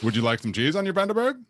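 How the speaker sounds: high, fast, bouncy mock chef; Swedish chef voice. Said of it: "sounds like a Swedish chef".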